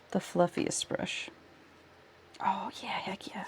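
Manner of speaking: soft breathy whisper, slowed. Real, not acted. Streamer whispers to herself while picking a brush, whispered. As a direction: hush it down to a whisper. Working quietly at the desk.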